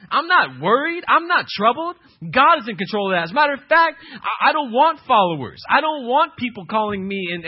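high, loud fiery preacher proclaiming; full-force preaching. Acted. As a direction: drive it home from the pulpit.

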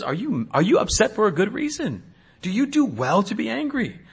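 soft, level, probing question; God's quiet question. Retold or imitated. God asking Jonah, soft and searching. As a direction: gently ask him to look at his anger.

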